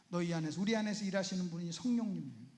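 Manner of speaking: usual speaking voice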